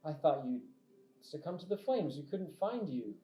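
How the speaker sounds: loud, high, exclaimed welcome; delighted knight. Acted. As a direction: hail a comrade you thought was lost. Abraham delighted to find lost comrade.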